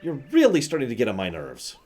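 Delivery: acted fed-up Bob-omb, pitch leaping up; exasperated outburst. Bob-omb begging his lovesick companion to stop, exasperated.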